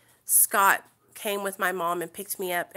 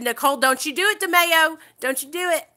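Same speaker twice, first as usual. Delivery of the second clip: higher, louder warning from the counselor; stern warning; 7 semitones higher, 6 dB louder than usual. Retold or imitated. Counselor Miss Edith warns Nicole not to chase Scott, loud and stern. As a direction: warn her off, louder each time.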